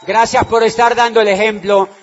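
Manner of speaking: loud, jubilant cry to the crowd; shouted praise. Real, not acted. Greeting the Venezuelan audience with a loud joyful shout. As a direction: shout it out with joy.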